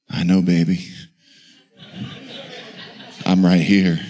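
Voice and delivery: low, mock-suave husband; hammed-up low bedroom voice; smooth reassurance. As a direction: drop low and croon it to your wife. Newlywed answering wife at night.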